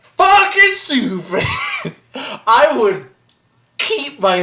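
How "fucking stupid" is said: high-pitched, laughing scorn; scornful outburst. Real, not acted. Asked about Arwen giving up immortality, scornful. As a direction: blurt the verdict in pitched-up derision. Instant verdict on Arwen's choice.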